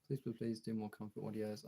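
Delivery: normal talking voice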